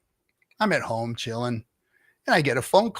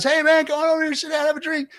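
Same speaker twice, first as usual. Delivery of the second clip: much higher, louder, overly friendly dude voice; naked dude host; 4 dB louder, faster than usual. Retold or imitated. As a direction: crank the pitch way up, a chummy host waving you in. Naked guy inviting him over.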